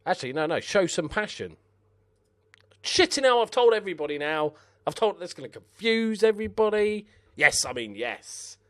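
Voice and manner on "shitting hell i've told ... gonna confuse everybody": high, loud frustrated outburst; exasperated. Real, not acted. Gamer curses after telling the whole team by mistake. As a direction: curse at your own misclick.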